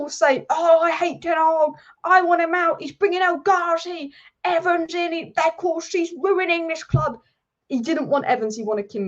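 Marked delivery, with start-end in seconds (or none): 0.5-7.2 sneering impression of a fan; mock angry fan. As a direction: parody a moaning fan. Mocking fans who blame Ten Hag.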